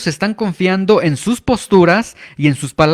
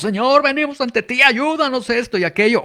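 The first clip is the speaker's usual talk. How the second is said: hammed-up fervent prayer, pitched up high; mock emotional cry-out prayer; 9 semitones higher than usual. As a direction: mimic someone crying out to heaven to sound powerful. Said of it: "si levantamos la voz y gritamos".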